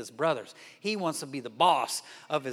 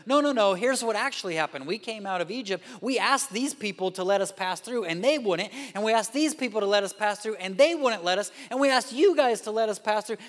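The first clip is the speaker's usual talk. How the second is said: higher and louder, pressing the point; heated insistence; 7 semitones higher, 6 dB louder than usual. Retold or imitated. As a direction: set the record straight, fired up. Jephthah arguing history heatedly.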